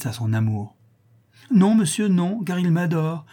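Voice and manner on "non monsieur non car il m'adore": higher-pitched, insistent denial; vehement protest. A narrator's reading. Countess contradicting the baron about her lover, vehement protest. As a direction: burst out in passionate protest.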